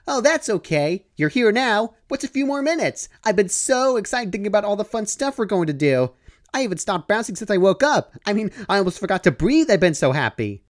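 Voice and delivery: high, bubbly, gushing Pinkie voice; gleefully reassuring. Acted. Pinkie tells Dash lateness is fine, high and gleeful. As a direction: reassure her while bursting with glee. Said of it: "responded in a gleefully reassuring tone".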